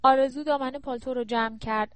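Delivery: usual speaking voice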